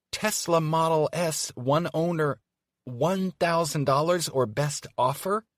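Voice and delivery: high loud slow outraged shout. Acted. Evie reading the ad aloud, outraged shout. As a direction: bellow each word in disbelief. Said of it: "she shouts".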